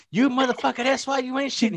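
hammed-up wife cursing out her husband; mock wife yelling. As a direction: rant at him like an angry wife. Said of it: "She's sitting there".

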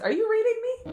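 very high, laughing question; mock-incredulous squeal through a laugh; playfully astonished, pitch shooting up; amused disbelief. Joking disbelief at son mirroring her.

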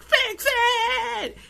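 mock shriek. Wife demanding he fix the dish, mock shriek. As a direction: screech the order. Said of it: "And she's just like".

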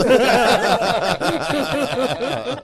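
loud, high burst of real laughter; big laugh. Real, not acted. Friend cracking up at his own photo story, loud laugh. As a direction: crack up loudly at the punchline.

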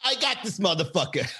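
high-pitched mock triumph with laughter; put-on victorious glee; gloating. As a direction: gloat as the scheming date, laughing. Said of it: "Then she'll be like".

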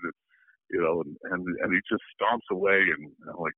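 normal talking voice

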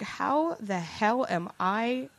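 slow, drawn-out disbelief; incredulous self-questioning. Retold or imitated. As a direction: spell out each word in mock exasperation. Exasperated inner thought, retold.

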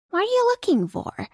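high call from below. Acted. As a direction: call up from the fog. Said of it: "a voice called out from below the fog".

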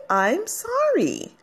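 teaching the apology, slow and high. Shown as a demonstration. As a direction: spell it out slowly for the listeners.

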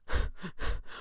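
acted convulsing chuckle; breathless burst of laughter; villain's laughter. A narrator's reading. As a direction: laugh helplessly at his pain. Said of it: "Thatcher's voice roared with laughter".

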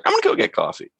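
very high squeaky mock nervous voice; nervous squeak. Frenchie freaks out offering coffee, very high squeaky voice. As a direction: squeak it out, flustered. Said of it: "He's like".

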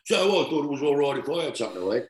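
half-hearted impression of a big-eating mate justifying himself; a hint of mock innocence, speeding up at the end; Merv Hughes. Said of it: "he says".

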